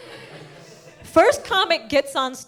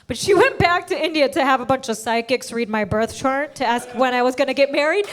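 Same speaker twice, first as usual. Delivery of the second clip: usual speaking voice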